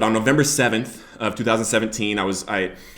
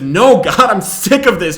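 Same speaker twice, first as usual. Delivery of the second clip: higher, louder desperate yell; yelling at God; 7 semitones higher, 8 dB louder, slower than usual. Retold or imitated. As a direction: shout it up at God in despair. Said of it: "I started yelling at him".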